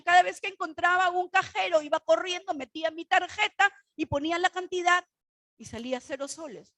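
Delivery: normal talking voice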